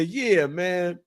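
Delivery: high, quick chiding; disapproving retold brother. Little brother sides with father against him, high chiding imitation.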